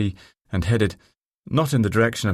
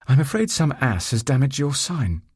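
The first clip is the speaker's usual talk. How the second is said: brisk female voice; 7 semitones higher than usual. A narrator's reading. Narrator voices Sophie.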